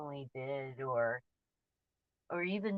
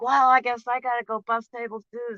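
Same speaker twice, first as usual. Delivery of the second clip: imitating the boss, louder and higher; sarcastic boss; 5 semitones higher, 7 dB louder than usual. Boss answering the waitress's complaint, sarcastic and louder. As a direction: play the boss's sarcastic retort, raised. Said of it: "and she's like".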